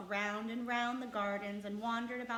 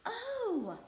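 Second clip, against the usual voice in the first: much higher, louder cry of delight; 14 semitones higher, 5 dB louder than usual. A narrator's reading. Mary greeting the robin, delighted cry. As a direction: cry out, thrilled. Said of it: "she cried out".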